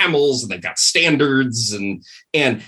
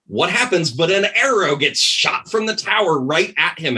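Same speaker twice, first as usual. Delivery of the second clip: ordinary delivery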